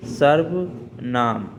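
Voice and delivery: drawn-out, syllable by syllable; slow emphatic repetition. Real, not acted. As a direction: stretch the word out slowly for students. Teacher repeats chapter name for students. (धीरे-धीरे खींचकर दोहराना)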